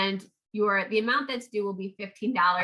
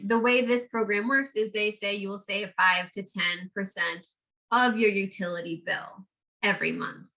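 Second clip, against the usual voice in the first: normal talking voice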